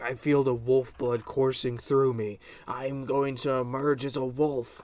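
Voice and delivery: dramatic wolf declaration. Retold or imitated. Boy on the table declaring himself wolf.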